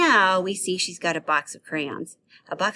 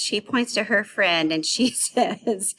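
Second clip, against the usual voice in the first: normal talking voice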